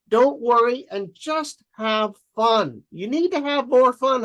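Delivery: high, slow, fond parent; doting parent voice. Retold or imitated. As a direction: coax her gently like a doting parent. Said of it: "How do you guys like Bill's acting".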